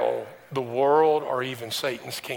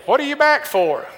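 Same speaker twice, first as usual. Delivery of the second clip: mock bafflement, pitched far above your usual voice; acted surprised question; 11 semitones higher, 6 dB louder than usual. Neighbor questions the pastor's return visit, acted surprise. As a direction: imitate her, high and loud.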